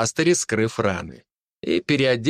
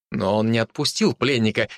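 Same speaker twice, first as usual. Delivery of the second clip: plain reading voice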